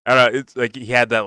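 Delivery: ordinary talk, nothing marked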